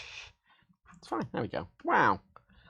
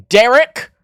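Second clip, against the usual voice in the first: announcer reveal; 8 semitones higher, 8 dB louder than usual. Pretend. Announcing a toy seal.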